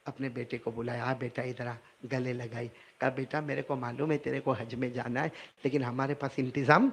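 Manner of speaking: soft, low, tender voice; mother softly comforting her son. Acted. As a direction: soothe the child gently. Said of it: "پھر ماں کو رحم آیا".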